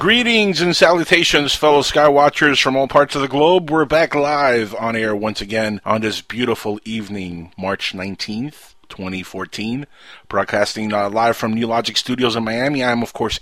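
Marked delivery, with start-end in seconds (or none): 0.0-11.5 big, loud radio host greeting, settling as it goes; radio show opening. Real, not acted. Host greets skywatchers worldwide as the show goes live, announcer style.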